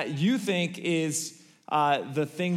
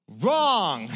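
emphatic wrong; 4 dB louder than usual. Acted. Rabbi rejects answer a second time.

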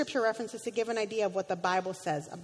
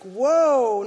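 surprised whoa; 8 dB louder than usual. Retold or imitated. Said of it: "I'm like".

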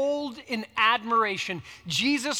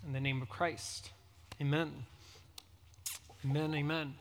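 low, soft, level praying voice; hushed prayer with pauses between phrases; closing prayer; 8 semitones lower, 12 dB quieter than usual. Real, not acted. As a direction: pray quietly and reverently, phrase by phrase. Closing prayer before the sermon.

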